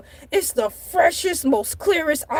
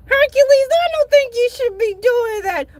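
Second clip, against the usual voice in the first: high, loud scolding grandma; a fussing grandma, pitched up; 6 semitones higher, 3 dB louder than usual. Acted. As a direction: play the grandma telling him off.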